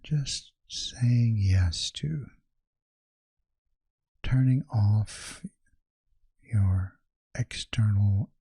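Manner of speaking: measured, soothing meditation guide; guided meditation. Acted. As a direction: ease the listener into calm, leaving space after each phrase.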